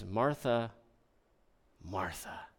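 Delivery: soft, fading call of her name; a hint of Jesus tenderly calling Martha. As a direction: say her name gently, the second time almost breathed. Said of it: "full of tenderness".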